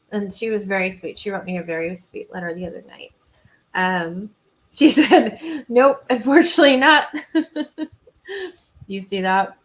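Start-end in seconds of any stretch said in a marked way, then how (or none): 4.7-7.9 laughing through the words, higher and much louder; amused laughing. Real, not acted. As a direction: read it out through real laughter. Reading out a reply, amused.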